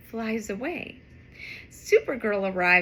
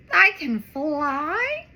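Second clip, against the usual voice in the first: amazed; 3 semitones higher, 5 dB louder, slower than usual. Acted. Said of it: "wonders the cat".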